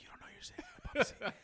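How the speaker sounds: whispered, hurried aside; hushed disbelief. Acted. Rider to an unaware stranger in the bum seat, whispered. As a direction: whisper it fast, holding back a laugh. Imagined silent reaction to a stranger.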